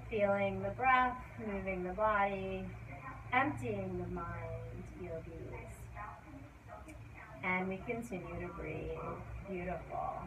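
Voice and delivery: soft, low and unhurried; soothing yoga voice. Acted. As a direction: ease them into stillness. Yoga teacher calming the class.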